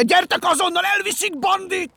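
high, very loud alarmed yell; frantic shout. A narrator's reading. Benyámin calling the animals to save Bandi, frantic shout. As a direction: scream for help at full force. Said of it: "üvöltötte".

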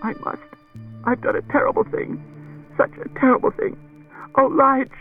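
very high, tearful confession; distraught. A narrator's reading. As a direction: come apart while confessing. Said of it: "She lapsed into incoherence".

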